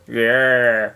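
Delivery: deep dopey yeah; low grunt. Pretend. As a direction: drop it low and dumb. Squeak and grunt routine.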